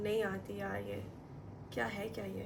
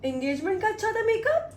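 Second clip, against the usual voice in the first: high, loud incredulous question; can't believe what mum said; disbelieving question; 8 semitones higher, 7 dB louder than usual. Real, not acted. Vlogger questions mum's verdict on her makeup, high incredulous.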